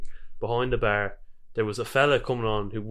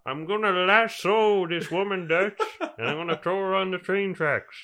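put-on Arthur Morgan accent; 8 semitones higher, 4 dB louder than usual. Gamer imitating the Red Dead character to his co-host, laughing cowboy accent. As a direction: call out like a desperate outlaw. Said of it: "The accent's so far but awful".

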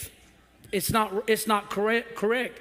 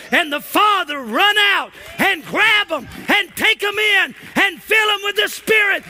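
rising to a shouted, much higher, louder preach; preaching at full force; 6 semitones higher, 8 dB louder than usual. Acted. Pastor to his congregation about God's forgiveness, full-force shouting preach. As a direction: build the congregation up until you're shouting it.

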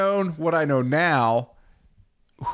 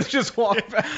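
laughing; 9 semitones higher than usual. Real, not acted. Laughing mid-story.

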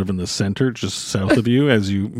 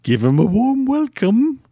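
eccentric hermit voice; 8 semitones higher, slower than usual. Acted. Hermit addressing mushrooms.

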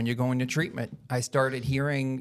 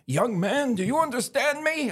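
stern father; 12 semitones higher than usual. Retold or imitated. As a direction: bark it like a furious dad laying down the law. Father's ultimatum at intervention.

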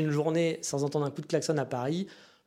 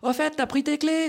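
high-pitched call across a busy street; imitating someone hollering at a friend in the street; street yelling; 12 semitones higher, 3 dB louder than usual. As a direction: shout to Michel through the crowd. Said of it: "qui vont hurler en pleine rue".

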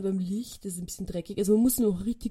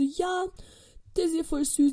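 neighbour's voice; 8 semitones higher than usual. Retold or imitated.

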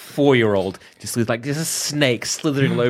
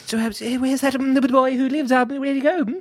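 higher, stammering headmaster impression; badly faked, halting old wizard; Dumbledore; 10 semitones higher than usual. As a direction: stammer along like Porky Pig. Dumbledore impression held in scene.

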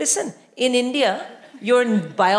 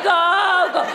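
cheering shout; 7 semitones higher, 4 dB louder than usual. Retold or imitated. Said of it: "I was like".

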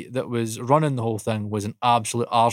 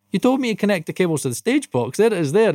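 high, baffled self-defence; indignant retort; 7 semitones higher than usual. Retold or imitated. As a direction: play the confused helper explaining. Self quoted defending, high pitch.

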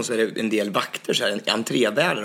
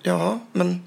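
high, halting stammer of disbelief; speechless stammering; 9 semitones higher, slower than usual. Retold or imitated. Dancer lost for words before the guard, stammering. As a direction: fumble for words, pitched up. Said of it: "Jag blev helt mållös".

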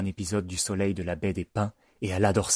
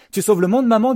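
put-on very high, loud child's call; excited kid shouting into the phone; Bennett; 9 dB louder than usual. A narrator's reading. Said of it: "dit Bennett".

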